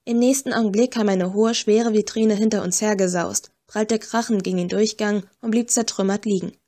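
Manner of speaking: ordinary delivery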